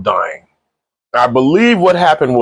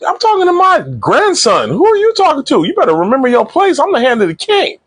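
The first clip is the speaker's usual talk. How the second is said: high-pitched, hammed-up outrage; mock indignant rebuke; 13 semitones higher than usual. King's Hand rebukes a guard over his grandson, high mock indignation. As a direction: pitch it way up, imitating an offended elder pulling rank.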